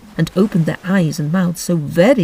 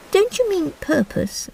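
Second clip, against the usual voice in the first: Alice voice; 12 semitones higher than usual. Acted. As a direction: speak like a polite little girl.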